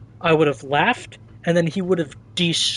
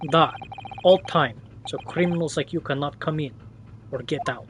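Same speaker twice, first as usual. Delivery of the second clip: lightly voiced Russian sailor, grumbling threat; a hint of a surly Russian seaman; Russian accent sailor. Sailor threatening to throw stowaway overboard, light Russian accent.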